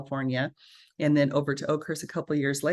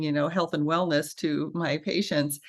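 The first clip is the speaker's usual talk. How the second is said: ordinary delivery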